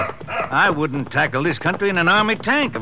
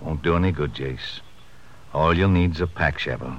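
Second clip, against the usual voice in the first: quiet sorrow; 11 semitones lower, 6 dB quieter than usual. Acted. Sheriff reports the dog died.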